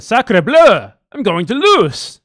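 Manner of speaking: put-on overdone French accent cry of dismay; mock French accent. Macron fearing defeat, in a hammed-up French accent. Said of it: "As French people do".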